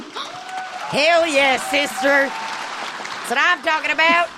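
high, excited cheer; cheering shout. Real, not acted. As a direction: cheer the speaker on.

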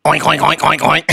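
quick string of pig oinks; pig oinking. Acted. Joke teller voices pigs greeting the apostle, oinking. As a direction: oink like a pig. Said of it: "the pigs look up and go".

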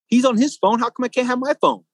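high-pitched mock whine of a child telling on someone; tattling little kid. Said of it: "a little kid tattletaling".

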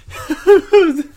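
high, loud burst of laughter; a sudden high laugh. Real, not acted. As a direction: crack up at the question. Laughs at question.

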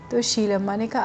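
usual speaking voice

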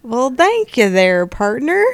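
slow, loud Texan cowboy voice; Texas accent. Acted. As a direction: ham up a slow Texas drawl. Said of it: "I'll pretend I'm from Texas".

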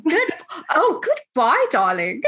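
a playful British "goodbye, darling", high on "goodbye"; hammed-up upper-class British send-off; mock British accent. Saying goodbye to her guest in a mock British accent. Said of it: "I can be British".

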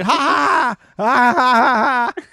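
big, high, blaring mock laughter; fake laugh. Retelling Bear's reaction to being caught, loud fake laugh. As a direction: belt out forced ha-has. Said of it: "Bear started fake laughing again".

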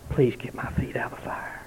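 a desperate, breathless whisper of a dying man; whispered plea. Retold or imitated. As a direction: whisper it, pleading in terror. Dying man's plea, retold.

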